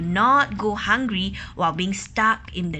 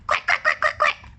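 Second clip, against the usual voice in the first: excited quacking. Acted. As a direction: quack up high like a worried duck. Ducks quacking.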